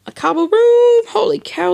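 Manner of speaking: very high squeaky exclamation; squealed mock awe. Gamer admiring his cobble room, high-pitched mock awe.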